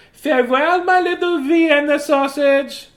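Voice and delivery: high, loud doting-mother farewell; doting mother calling out. A narrator's reading. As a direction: sing it out across the yard like a proud mom. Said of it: "waving and called out".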